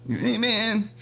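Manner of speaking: high-pitched burnout voice; Ralph the Cat. Acted. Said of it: "something with that voice".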